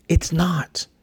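whispered, unvoiced. Real, not acted. A host whispering the blunt answer to listeners. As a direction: breathe it as a hushed aside.

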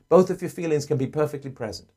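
usual speaking voice

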